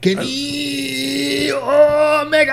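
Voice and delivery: very slow, high, swooning name; drawn-out swoon. Pretend. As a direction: stretch the name out dreamily.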